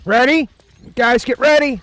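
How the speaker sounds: high, loud rallying yell; excited shout. Real, not acted. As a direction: hype the team up, full voice. Rallying team before big push.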